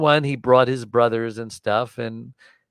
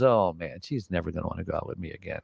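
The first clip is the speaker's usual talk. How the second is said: worried mutter; 5 semitones lower, 10 dB quieter, faster than usual. Retold or imitated. Inner worry about girlfriend.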